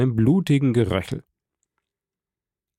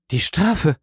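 high, shocked question; startled cry, lightly acted; alarmed woman; 6 semitones higher than usual. A narrator's reading. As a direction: voice a horrified wife, higher. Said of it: "rief Frau von Villefort".

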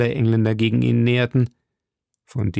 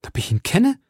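higher, bitter cry; anguished outburst; 9 semitones higher than usual. A narrator's reading. Milady answers Felton with a bitter outcry. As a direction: throw the question back in pain.

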